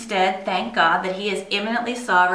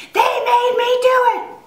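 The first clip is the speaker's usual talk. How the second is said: put-on high, loud childish scream of protest; kid's scream; 8 dB louder than usual. Child screaming at her dad to blame her sisters. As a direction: shriek it like a furious kid blaming others. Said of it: "I would literally scream at him".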